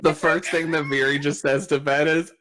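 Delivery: normal talking voice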